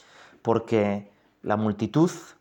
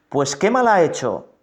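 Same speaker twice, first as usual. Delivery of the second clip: higher, louder protesting question; raised-voice challenge; 6 semitones higher, 5 dB louder than usual. A narrator's reading. Pilato questions the shouting crowd, raised-voice challenge.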